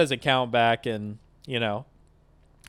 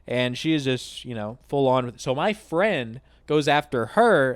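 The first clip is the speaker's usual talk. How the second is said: ordinary talk, nothing marked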